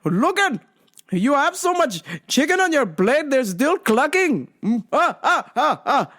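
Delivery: high, swooping dad voice; overdone dad gag ending in fake laughing; joking dad. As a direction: imitate dad hamming up his astonishment. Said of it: "he's just like".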